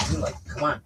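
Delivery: pet-talk nudge to go outside; coaxing the dog. Real, not acted. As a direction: urge the dog along playfully.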